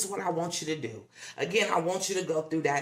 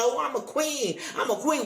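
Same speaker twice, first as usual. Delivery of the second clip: higher, hammed-up holler of a self-styled queen; mock the show-off shouting she's a queen; 7 semitones higher than usual. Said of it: "but I'm hollering".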